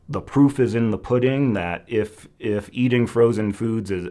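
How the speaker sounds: ordinary talk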